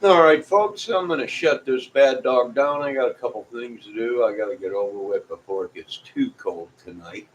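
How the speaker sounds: ordinary talk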